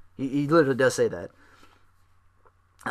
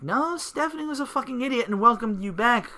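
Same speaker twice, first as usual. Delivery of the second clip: higher, mock outraged retort to the screen; 10 semitones higher than usual. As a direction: snap back at the wrestler in a higher put-on voice. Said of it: "and I'm like".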